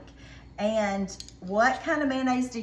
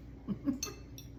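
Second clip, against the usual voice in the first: soft breathy giggle; 5 semitones higher, 9 dB quieter than usual. Real, not acted. Laughs while tasting.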